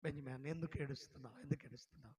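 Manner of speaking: soft, tender asking, as a kind elder; gentle question. Retold or imitated. Kind stranger consoling the weeping graduate, softly and tenderly. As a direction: ask softly, comforting him.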